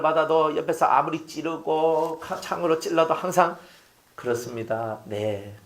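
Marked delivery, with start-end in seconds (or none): 4.2-5.6 much softer and lower, meek yes; meek agreement. Retold or imitated. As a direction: calmly give in without a fight. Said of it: "격조 있는 그런 삶". (조용하고 공손하게 수긍하는 말투)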